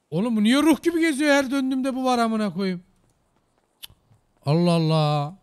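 freaked-out loud complaint; startled shout. Real, not acted. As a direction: shout in alarm at the thing that keeps appearing. Startled by ghostly figure.

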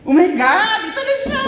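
loud, high-pitched squeal; excited reveal. Real, not acted. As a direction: burst out shrieking at the big reveal. Big reveal of the phone. (sabik na tili)

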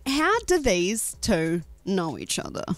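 ordinary delivery

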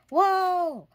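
a high, loud startled whoa; falling whoa. A narrator's reading. The whole family tumbling backwards as the turnip lets go. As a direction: yelp as you topple over.

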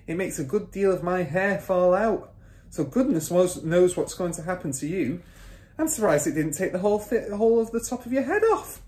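high-pitched scolding warning; mother's voice. A narrator's reading. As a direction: tell him off as the wife. Mother warns husband.

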